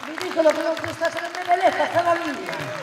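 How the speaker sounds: high, loud accusing shout; booming balcony speech. Real, not acted. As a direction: hurl it at the crowd. Shouting attack on opponent "Duce.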